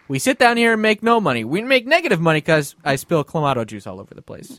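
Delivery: ordinary talk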